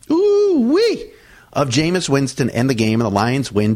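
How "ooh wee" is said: excited whoop. Real, not acted. Delighted reaction to a sack.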